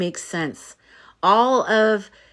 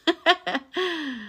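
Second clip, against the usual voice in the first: a high burst of real laughter; 10 semitones higher, 5 dB quieter than usual. Real, not acted. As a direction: genuine delighted laugh. Laughs with a viewer.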